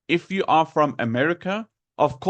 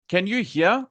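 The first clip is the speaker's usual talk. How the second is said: usual speaking voice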